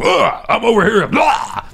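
high-pitched mock game cries; video game scream. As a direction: shout like a game character. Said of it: "like doing a video game where you're just screaming".

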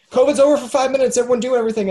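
high fast loud proclamation; loud mock announcement. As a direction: announce it like a herald.

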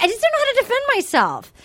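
high, fast whiny quote; imitating a whiny plea; whiny mock voice. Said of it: "she's like".

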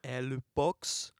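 slow, put-on French mime dialect; slow French-accented translation for the mime. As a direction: draw it out in mock French. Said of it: "He's doing a French accent".

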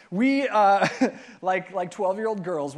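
ordinary talk, nothing marked

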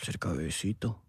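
susurro muy suave, casi inaudible; susurro cercano. Pretend. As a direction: susurra pegado al micrófono. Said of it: "que tú hables cerca". (cuchicheo íntimo de broma)

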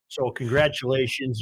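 ordinary talk, nothing marked

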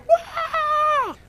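very high, loud mock kid scream; imitated shriek of an excited crowd of children; sustained high "wah" scream. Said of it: "why are they screaming?".

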